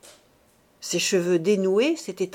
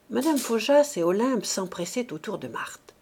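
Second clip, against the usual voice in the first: ordinary delivery, nothing marked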